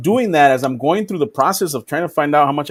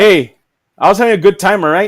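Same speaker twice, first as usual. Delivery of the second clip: loud defensive; 3 semitones higher, 6 dB louder than usual. Real, not acted. Defending drunk fun, loud.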